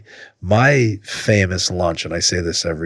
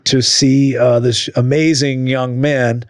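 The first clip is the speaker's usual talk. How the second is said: higher, projected sign-off voice; host outro; 7 semitones higher, 4 dB louder than usual. Real, not acted. Host asking guest to plug social media, projected outro. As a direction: lift it into an upbeat plug for the guest.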